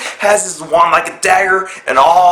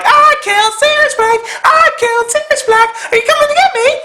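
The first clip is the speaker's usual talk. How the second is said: taunting shriek. Retold or imitated. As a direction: shriek it like a gleeful mad witch. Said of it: "she's yelling, she's taunting him".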